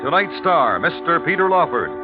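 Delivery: usual speaking voice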